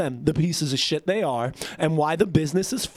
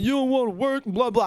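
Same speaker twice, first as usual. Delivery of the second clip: higher-pitched mocking quote of a ranting boss; 7 semitones higher than usual. Worker imitating his boss talking shit, mocking. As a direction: parrot the boss's nagging dismissively.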